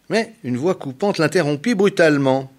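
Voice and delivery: usual speaking voice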